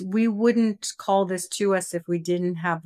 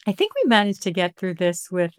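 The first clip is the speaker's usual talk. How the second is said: ordinary talk, nothing marked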